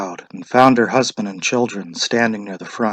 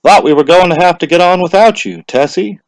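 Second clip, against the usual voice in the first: louder, higher cheerful greeting; cheerful official; 8 semitones higher, 8 dB louder than usual. A narrator's reading. Official greets the late wife, cheerful and louder. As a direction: tease her kindly for being late. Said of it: "said cheerfully".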